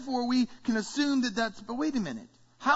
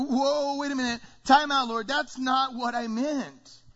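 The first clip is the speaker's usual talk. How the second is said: higher, drawn-out protest; alarmed objection; 4 semitones higher, 4 dB louder than usual. Acted. Believers balk at God's answer, alarmed objection. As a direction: call a halt, pitch raised. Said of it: "we're gonna go".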